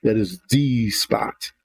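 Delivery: THE said as a long "thee" before spot; emphatic "thee". Shown as a demonstration. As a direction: slowly lean on THE as "thee". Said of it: "put an emphasis on it".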